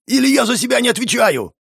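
high-pitched, frantic warning; wailing threat. A narrator's reading. Wounded pyromancer threatening to lose control, wailing. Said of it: "завопил пиромант".

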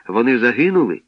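usual speaking voice

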